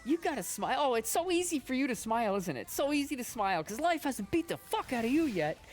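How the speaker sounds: high, loud ranting comic impression; Orny Adams rant. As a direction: yell it like a fed-up comic on stage. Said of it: "He's like".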